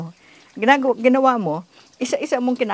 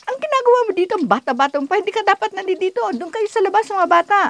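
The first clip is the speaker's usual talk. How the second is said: loud, high-pitched mock scolding; retold scolding; 11 semitones higher, 5 dB louder than usual. Soldier scolding a child who squeezed through the courtroom, retold scolding. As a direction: imitate an indignant telling-off, pitched way up. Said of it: "pinagalitan ka".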